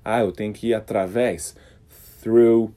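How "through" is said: "through" with clear unvoiced th. Shown as a demonstration. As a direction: push air through teeth on "through". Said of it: "com esse T H desvozeado".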